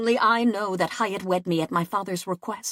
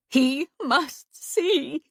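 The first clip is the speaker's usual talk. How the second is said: slow, high, tearful; weeping; 5 semitones higher, much slower than usual. A narrator's reading. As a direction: draw it out through tears. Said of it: "she wept".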